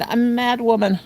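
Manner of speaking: ordinary delivery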